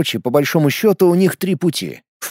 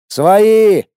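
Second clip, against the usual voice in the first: shouted call; 5 semitones higher, 5 dB louder than usual. Acted. Calling out on landing.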